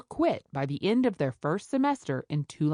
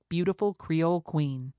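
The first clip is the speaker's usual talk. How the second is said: plain reading voice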